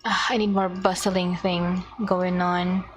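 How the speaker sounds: ordinary talk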